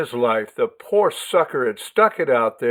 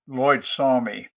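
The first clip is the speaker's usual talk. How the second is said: ordinary delivery, nothing marked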